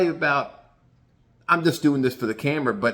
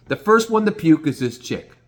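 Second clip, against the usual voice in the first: ordinary talk, nothing marked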